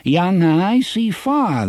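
put-on high quavery temporizing old man; quavering old man. A narrator's reading. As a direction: stall them in a shaky old voice. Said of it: "his quavering voice".

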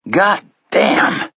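high, strained cry through a tight throat; choked cry. A narrator's reading. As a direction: blurt it out, choking on it. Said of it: "he cried in a choked voice".

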